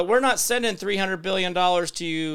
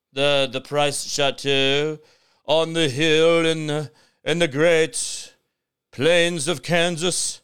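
slow, grand mock French accent; a hammed-up posh French estate voice; mock fancy French; slower than usual. Showing off his pricey Kansas home, grand mock French accent. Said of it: "sounded very fancy".

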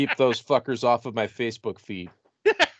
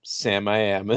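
ordinary talk, nothing marked

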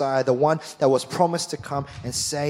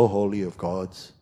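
much lower and softer, empty piety; mock pious; 8 semitones lower, 5 dB quieter than usual. Pastor mocking ritual worship, low and soft. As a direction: mimic a hollow churchgoer. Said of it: "You turn up and go".

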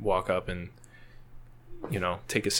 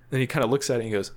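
ordinary talk

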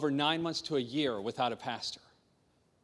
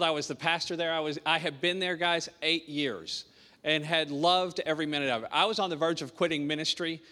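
usual speaking voice

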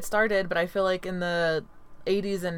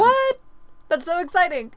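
high, loud amazed squeal; mock surprise; 12 semitones higher, 6 dB louder than usual. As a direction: overplay the shock.

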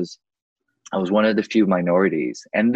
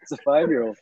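teasing laugh; 7 semitones higher than usual. Real, not acted. Laughing as he teases his friend about rebelling young. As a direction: tease her through laughter.

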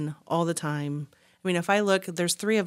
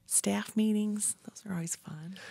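whispering; 3 semitones higher, 5 dB quieter than usual. Real, not acted. Whispered sarcastic aside.

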